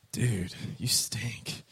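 slow hushed near-whisper; low, drawn-out disgusted murmur; stunned onlooker. Acted. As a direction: whisper it slowly in mock disbelief. Stunned onlooker to slimy Jonah.

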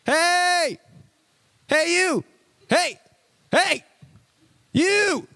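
high-pitched, drawn-out calls; yelling for someone. Acted. Yelling for a kid in the supermarket, high hollered calls. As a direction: holler as if across a crowded store. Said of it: "I was yelling for you in the supermarket".

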